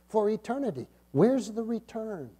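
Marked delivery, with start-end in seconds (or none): none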